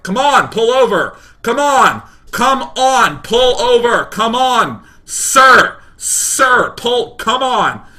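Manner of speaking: high, loud barked police orders; annoyed megaphone cop. Retold or imitated. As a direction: bellow impatiently like an officer on a megaphone. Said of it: "a really annoyed police officer on a megaphone".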